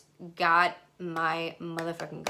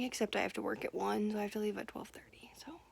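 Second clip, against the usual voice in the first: whisper; 7 dB quieter, faster than usual. Real, not acted. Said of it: "fast whispered schedule".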